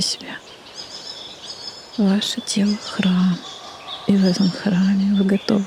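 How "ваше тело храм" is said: ordinary delivery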